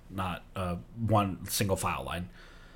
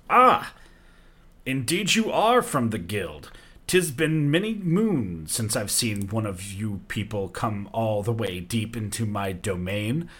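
regal desert giant; 4 dB louder than usual. Acted. The giant king greeting wanderers on his dunes, grand and amused. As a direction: savour every word like a king granting an audience.

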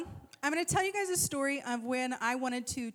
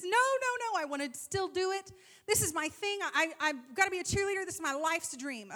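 high, insistent imitation of a kid; pleading daughter; 5 semitones higher than usual. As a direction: protest high and fast like a little girl. Daughter pleading to stay, retold.

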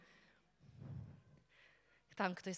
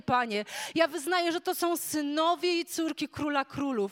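louder, higher declaring prayer; fervent prayer; 5 semitones higher, 10 dB louder than usual. Acted. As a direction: proclaim freedom over the people. Loud declaring prayer over people. (głośna, żarliwa modlitwa)

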